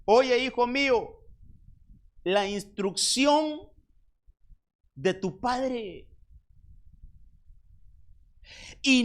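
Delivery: consejo de madre, lento, agudo y fuerte; madre que aconseja. Acted. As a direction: aconseja al hijo despacio y con fuerza. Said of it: "Una madre". (la madre instruye al hijo, lenta y enfática)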